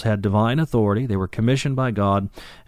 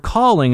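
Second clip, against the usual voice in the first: calling said much higher with a falling end; stressed calling; 10 semitones higher, 3 dB louder than usual. Shown as a demonstration. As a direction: lift calling high and let it fall. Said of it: "is in the middle voice".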